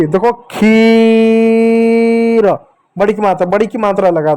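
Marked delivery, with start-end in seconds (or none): 0.5-2.5 "खीर" stretched very slowly with a held ee; long drawn word. Shown as a demonstration. Teacher drawing out the word for children to repeat. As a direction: hold "खीर" out long and even. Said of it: "बड़ी की मात्रा लगी".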